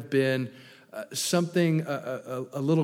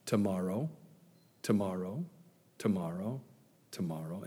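each "tomorrow" lower and softer until it's gone; fading away; 8 semitones lower, 10 dB quieter than usual. Acted. Sinner endlessly putting off repentance, fading out. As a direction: let the promise trail off into nothing.

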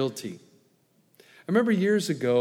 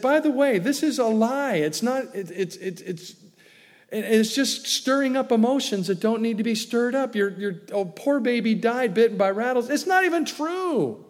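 indignant correction; 7 semitones higher than usual. Retold or imitated. Retells phoning to correct the lady.

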